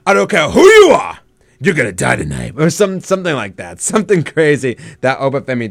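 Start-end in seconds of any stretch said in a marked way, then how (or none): 0.0-2.5 mock wrestler threat. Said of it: "he's gonna say something".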